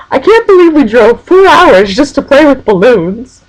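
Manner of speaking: high, drawn-out mock disbelief; imitated incredulity. Someone marvels at driving hours for balloons, mock incredulous. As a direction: exaggerate the disbelief, pitched high and slow. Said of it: "somebody said".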